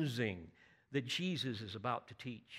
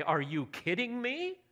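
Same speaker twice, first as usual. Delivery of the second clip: incredulous; 8 semitones higher than usual. Retold or imitated. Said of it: "We would probably say".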